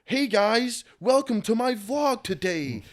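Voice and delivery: high, slow, sing-song YouTuber greeting; mock vlogger. Rapper mocks a cheesy vlogger greeting to show he isn't one. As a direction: parody a chirpy vlogger intro. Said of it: "that's not my personality".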